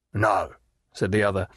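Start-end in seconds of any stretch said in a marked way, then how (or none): none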